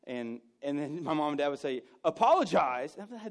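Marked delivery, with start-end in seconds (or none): 2.0-2.9 loud, forceful command; stern parental order. Acted. Parents ordering their son to apologize, loud and stern. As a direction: bark it like a fed-up parent.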